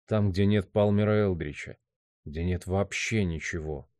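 soft, inward monologue; hushed, troubled musing; brooding man's thoughts. Acted. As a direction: question yourself quietly and bleakly.

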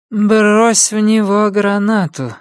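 slow, high, dazed female voice; woman's slow high mumble; muttering mother. A narrator's reading. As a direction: drawl the order dreamily, pitched high. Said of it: "пробормотала мать".

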